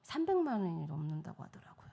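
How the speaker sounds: real whisper about the price; hushed aside. Real, not acted. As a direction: drop to a breathy secret aside.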